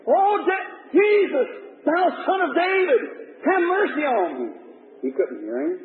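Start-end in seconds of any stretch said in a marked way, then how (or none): 0.1-4.5 crying out for mercy. Acted. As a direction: call out to be heard over the crowd. Blind man cries out to Jesus.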